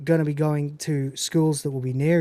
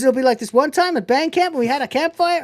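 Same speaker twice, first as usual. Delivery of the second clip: put-on much higher, fast babbling girl voice; chirpy band geek; 10 semitones higher, 3 dB louder, faster than usual. High eager girl voice as Michelle rambles about band camp to Jim. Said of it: "He goes".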